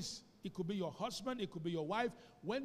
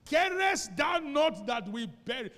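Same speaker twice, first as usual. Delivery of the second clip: loud, slow, desperate plea from the disciples; panicked cry; 6 semitones higher, 9 dB louder, slower than usual. Acted. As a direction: cry out in terror of drowning. Disciples crying out in storm.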